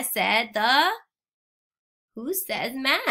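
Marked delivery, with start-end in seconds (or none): none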